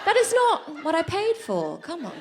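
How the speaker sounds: high-pitched, exasperated protest; let-down lover demanding better; indignant customer. Acted. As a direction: complain in comic disbelief, voice shooting up. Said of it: "You're like".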